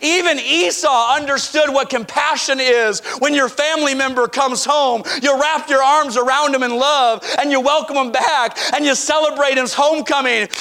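normal talking voice

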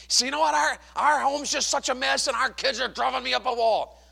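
a hint of a fed-up spouse; complaining wife.

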